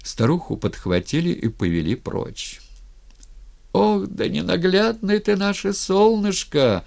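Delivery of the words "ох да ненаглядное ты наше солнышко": high, singsong wail; held, high mourning cry; keening old woman. A narrator's reading. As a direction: keep wailing the lament while being dragged off. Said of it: "ещё пыталась голосить старуха".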